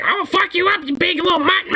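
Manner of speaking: a tiny dog threatening in a high mock voice; tough little dog. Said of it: "that dog actually is like".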